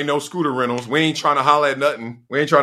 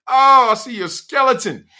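mock horror; 10 semitones higher, 4 dB louder than usual.

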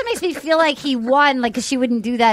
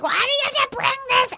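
high, fast exasperated shriek; mock shout; 12 semitones higher, 3 dB quieter, faster than usual. Angry woman shrieking at her man, high fast shout. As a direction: shriek it at him like you're at your limit. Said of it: "she was making a lot of noises".

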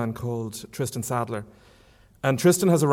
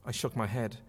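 ordinary delivery

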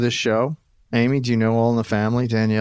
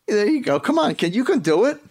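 much higher, coaxing impression voice; high Edith-like voice; 11 semitones higher than usual. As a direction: egg them on in a put-on high voice. Coaxing co-host, still in high Edith voice.

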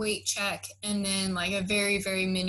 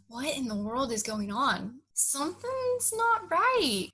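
suspicious disbelief; 5 semitones higher, slower than usual. Retold or imitated. Suspicious after casual call.

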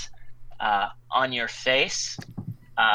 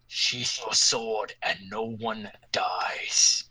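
menacing whisper; 2 semitones lower, slower than usual. Acted. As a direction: threaten him in a slow whisper. Warlock threatens Ixen.

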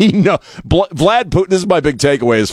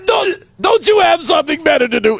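Gilbert Gottfried shout. Pretend. Host squawks the opening quote as Gilbert Gottfried. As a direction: screech the question like a grating comedian.

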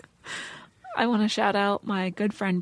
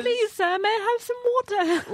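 high, pleading mock orphan voice; Oliver Twist begging; 11 semitones higher than usual. Joining in, mimicking the friend begging for gruel. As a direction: beg for water like a workhouse boy. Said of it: "like she was asking for gruel".

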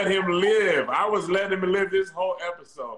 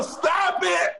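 shouting; 11 semitones higher, 5 dB louder than usual. Real, not acted. Playful protest.

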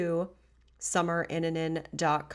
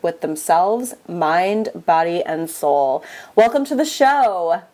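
bright, louder announcer read of a guest bio; podcast host intro; 8 dB louder than usual. Acted. Host welcoming listeners with a polished intro read.

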